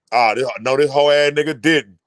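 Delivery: low, muttered-to-himself mock disbelief; imitated disbelief. Imitating a father told to shut up, low disbelief. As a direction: play the insulted father, pitched low. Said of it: "He looking like".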